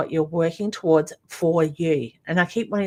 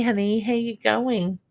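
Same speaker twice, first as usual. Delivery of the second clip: ordinary talk, nothing marked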